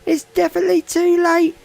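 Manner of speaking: real breathless high-pitched excitement; excited finish call. Real, not acted. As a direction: ride the high of the last lap.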